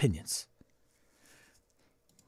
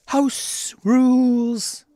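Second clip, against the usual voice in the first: announcer voice; 11 semitones higher, 4 dB louder, much slower than usual. Pretend. Announcing the show segment.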